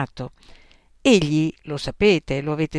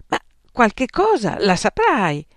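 pressing examiner; 6 semitones higher, 6 dB louder than usual. Acted.

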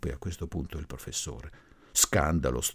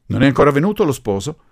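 loud, fast, higher demand; imperious; 8 semitones higher, 11 dB louder, faster than usual. A narrator's reading. Professor addressing the assembled guests, imperious. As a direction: demand it like one in command. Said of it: "sorpresi dal tono imperioso della voce".

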